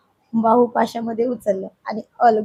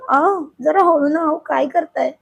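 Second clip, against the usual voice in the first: higher-pitched acted wifely complaint; pained protest; 6 semitones higher than usual. A narrator's reading. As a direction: protest at the bite with lifted voice. Bitten wife protests in story.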